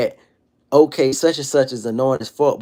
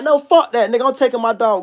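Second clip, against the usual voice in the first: higher, sharp mock defiance; defiant refusal; 7 semitones higher than usual. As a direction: throw it back like picking a fight. Acted refusal, stealing the fight.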